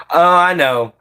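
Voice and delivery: ordinary talk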